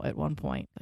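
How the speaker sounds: ordinary talk, nothing marked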